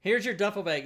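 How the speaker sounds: lightly mimicked army instructor; acted army order. Acted army orders after training.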